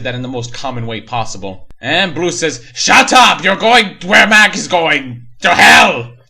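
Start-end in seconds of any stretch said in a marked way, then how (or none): 2.7-6.2 high, loud, slow roar; the killer roars at the rabbit guy, high and loud; hammy villain shout. Retold or imitated. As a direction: yell the threat like a hammed-up demon. Blue threatens the rabbit guy.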